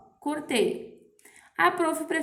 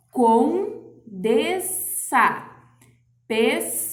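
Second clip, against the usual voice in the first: clear classroom demo; 6 dB louder, slower than usual. Shown as a demonstration. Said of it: "é que nem quando a gente fala".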